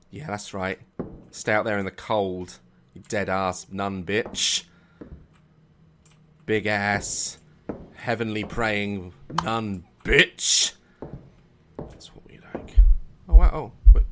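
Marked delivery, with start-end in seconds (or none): 0.1-10.7 slow gloating mutter; smug low jeer; low taunting. Real, not acted. 11.8-12.8 smug whisper. Real, not acted. Whispered after taunt.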